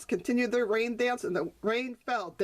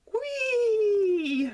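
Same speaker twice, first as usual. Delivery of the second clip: gleeful whee; 11 semitones higher, 5 dB louder than usual. Acted. Joyful cry on floating log.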